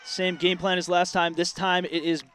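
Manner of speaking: energetic sportscaster calling the play. Acted. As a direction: call it like live football radio. Live play-by-play call.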